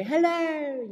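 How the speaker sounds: high, bright call into a cave. Shown as a demonstration. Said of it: "if you're in a cave and you say".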